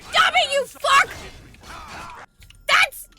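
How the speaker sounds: angry yelling. Real, not acted. Yelling at enemy attacking guard.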